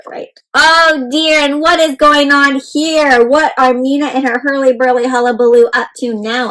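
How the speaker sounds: ordinary delivery, nothing marked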